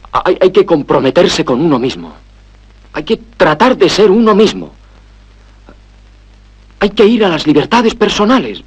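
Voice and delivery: arranca tartamudeando y sube muy agudo; proclama exaltada. Acted. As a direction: proclama solemne que se va encendiendo. (exaltado, alargando las palabras en lo alto)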